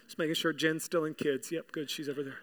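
low, soft offhand check; murmured low, away from the audience; quiet aside. Real, not acted. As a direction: drop the voice for a private aside.

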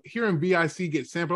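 ordinary talk

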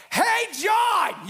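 sudden high, loud shout of a name; booming call from behind; Jesus calling out. Acted. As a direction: call out to him from behind, startling. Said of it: "and he's like".

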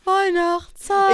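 high, loud, slow echo; drawn-out loud call; stretched jingle. Shown as a demonstration. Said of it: "noch mal von vorne".

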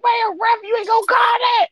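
high-pitched, outraged sports-fan shout; indignant retold shouting, voice way up; retold yell at ref. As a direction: holler up high at the referee, reenacting it. Acts out yelling at referee.

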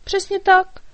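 high, loud little fairy cry; fairy's exclaim. A narrator's reading. Fairy cries out agreement to the dragon, high and loud. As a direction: shout "Přesně tak" high and bright. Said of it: "vykřikla Markétka".

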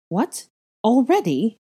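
high, loud burst of surprise; disbelieving, pitch jumping up; startled exclamation. A narrator's reading. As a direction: blurt it out in alarm. Mother startled by urgent news.

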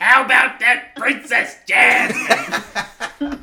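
high, loud, slow parrot squawk; Iago parrot voice. Acted. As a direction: drag it out in a screeching parrot voice. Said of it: "work on a Iago the parrot impression".

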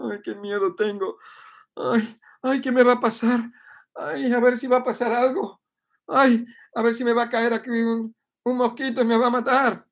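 high, whiny put-on fear; mock fearful whine. Mocking people living in fear, high frightened whine. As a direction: wail like someone scared of a mosquito. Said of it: "Eso es un tono bajo".

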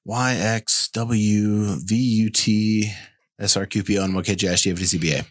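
reciting the alphabet backwards, fast; backwards speech. Pretend. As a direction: rattle off the ABCs in reverse. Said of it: "So I'm like".

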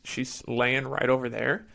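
usual speaking voice

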